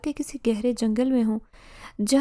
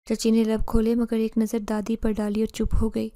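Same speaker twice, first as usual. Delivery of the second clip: plain reading voice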